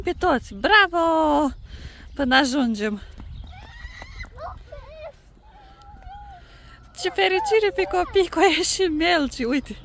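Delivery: high, loud delighted voice; excited. Real, not acted. Counting snails with the kids.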